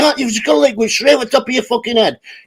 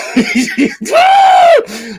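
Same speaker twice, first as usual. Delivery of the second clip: squeaky shrieking laugh. Real, not acted. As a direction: let out a high squealing laugh.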